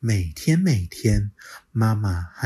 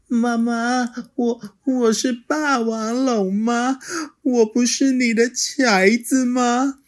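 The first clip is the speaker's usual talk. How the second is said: high, mildly tearful asking; a touch of sniffly pleading; tearful child; 4 dB louder than usual. Acted. Little dinosaur asking mother through tears, mildly tearful. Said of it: "小梁流着眼泪吸着鼻子说".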